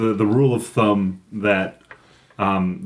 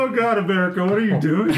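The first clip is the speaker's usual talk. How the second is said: laughing exasperation; 8 semitones higher, faster than usual. Real, not acted. Exasperated joking outburst.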